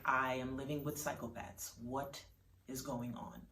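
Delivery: low, quiet disbelief; understated exasperation, dropped low and soft; concerned. A narrator's reading. Mom facing her laughing child, low and quietly concerned. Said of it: "her mom gets concerned".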